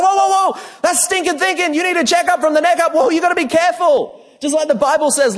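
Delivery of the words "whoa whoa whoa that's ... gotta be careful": high, loud mock alarm, rushed; alarmed correction. Speaker warning the swimmer, high and loud mock alarm. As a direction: pull him up short, half-joking. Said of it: "I said".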